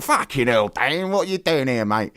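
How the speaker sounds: hammed-up Cockney mate, pitched high; high caricature of a Cockney greeting; mock Cockney. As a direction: put on a silly, inaccurate Cockney voice for a friend. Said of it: "that's not his voice".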